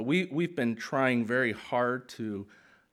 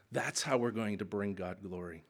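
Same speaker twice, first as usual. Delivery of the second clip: normal talking voice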